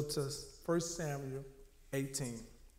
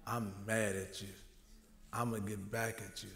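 low, soft resentful threat; understated anger, pitched low and quiet; quiet grudge; 5 semitones lower, 6 dB quieter than usual. Acted. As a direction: nurse the grudge under your voice. Preacher voices Saul's silent grudge.

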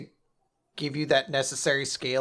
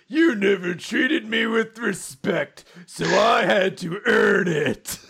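slow, raised, hammed-up villain speech; Taiju; 6 semitones higher, 4 dB louder, slower than usual. Joking that Taiju is the culprit, melodramatic mock confession. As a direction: put on a drawn-out theatrical grievance voice.

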